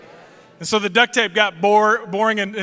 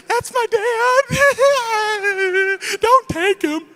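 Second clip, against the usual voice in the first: put-on much higher, slow, sobbing child plea; mock crying plea; 12 semitones higher, slower than usual. Scared kid begging the trooper not to take dad, hammed-up sobbing. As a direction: wail like a terrified kid begging. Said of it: "I'm about ready to be like".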